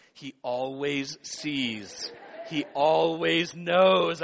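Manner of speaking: very slow, drawn-out echo; echo in his head. Retold or imitated. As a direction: stretch each word like a haunting echo. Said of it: "in his head is this echo".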